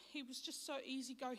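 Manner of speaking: normal talking voice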